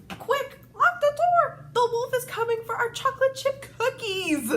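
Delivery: high, loud panicked cry; scared piggies. Acted. Frightened piggies begging their brother to lock the door, high and loud. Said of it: "They were scared".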